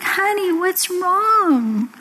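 high, slow, tender coaxing; concerned daddy. Acted. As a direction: gently draw out a parent's worried question. Daddy consoling his sobbing daughter.

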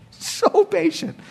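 so patient stretched slow and high; drawn-out so patient. Shown as a demonstration. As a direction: linger on so patient in wonder. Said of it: "He's so patient".

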